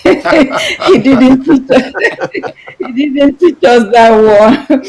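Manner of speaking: laughing. Real, not acted. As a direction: let the laugh break up the words. Amused aside about her dad.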